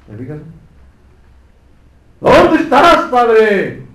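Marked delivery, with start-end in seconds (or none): 2.2-3.8 sudden high cry to the Father; anguished cry. Acted. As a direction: call out in anguish, voice rising. Preacher voices Christ crying out.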